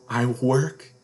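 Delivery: ordinary delivery, nothing marked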